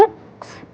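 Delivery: "एक्स" as a ks blend; letter sound demo. Shown as a demonstration. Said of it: "X की sound होती है".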